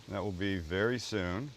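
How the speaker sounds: normal talking voice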